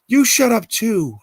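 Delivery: high, loud snapping scream; frantic yell. Acted. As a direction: scream at everyone to shut up. Alexis yells at hallucination.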